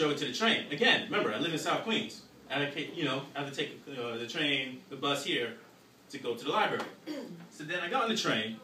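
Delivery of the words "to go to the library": normal talking voice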